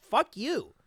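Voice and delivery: high-pitched mock outburst; retold angry swearing. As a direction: throw it out like a heated insult. Retelling swearing at ejected customer.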